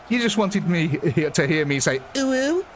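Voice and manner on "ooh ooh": put-on high, squeaky-cute little coo; high-pitched. Streamer obliging a viewer who wanted to hear it, high-pitched. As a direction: say it way up high, rising at the end. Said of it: "It hurts my voice to go that high, high-pitched".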